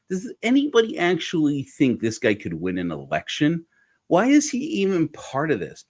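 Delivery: high-pitched recalled disbelief; baffled rhetorical questions, voice lifted; incredulous. Retold or imitated. Recalling own incredulous thoughts.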